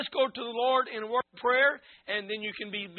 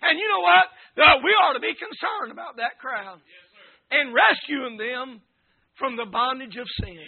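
fervent preaching; 7 dB louder than usual. Real, not acted. Preacher telling congregation they're no better than anybody, fervent preaching. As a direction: preach it hard, punching key words loudly.

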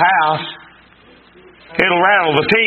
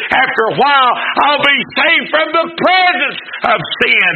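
preaching at full force; 7 semitones higher than usual. Acted. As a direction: lift it into a hallelujah peak. Preaching climax, hallelujah.

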